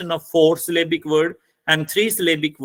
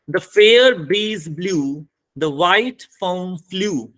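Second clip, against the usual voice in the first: slow, measured poetry line, each word weighted; slow verse reading; much slower than usual. Shown as a demonstration. Teacher reading an example of internal rhyme, slow verse reading. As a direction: read the verse out deliberately, word by word.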